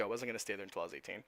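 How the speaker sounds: ordinary delivery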